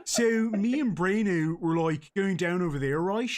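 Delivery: posh South Dublin chatter; South Dublin accent. Acted. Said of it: "in the South, they say".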